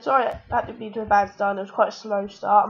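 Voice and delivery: ordinary talk, nothing marked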